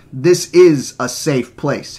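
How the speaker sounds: "is" hit loud and long; loud, slow contradiction stressing "is"; emphatic insistence. Acted. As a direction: insist slowly, leaning on "is". Quarrel, insisting against accusation.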